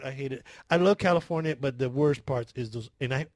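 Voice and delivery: ordinary talk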